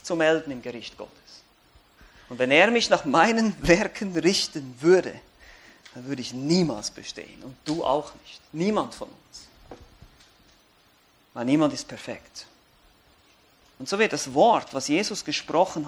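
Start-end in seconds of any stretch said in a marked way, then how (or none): none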